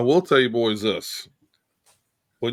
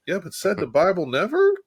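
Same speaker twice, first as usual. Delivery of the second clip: "said the Bible never?" pitched higher, ending in a questioning rise; a raised, teasing rhetorical question on "never"; mock incredulous; 7 semitones higher than usual. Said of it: "I'm going".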